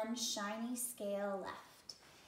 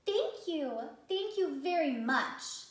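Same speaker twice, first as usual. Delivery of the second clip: high, loud and bouncy thanks; playful bubbling thanks; 5 semitones higher, 6 dB louder, slower than usual. Acted. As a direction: bubble over with joy. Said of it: "bubbled playfully".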